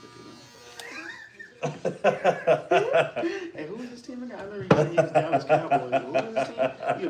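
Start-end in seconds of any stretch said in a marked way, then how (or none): 0.4-3.5 loud high laugh. Real, not acted. Laughing at arrival.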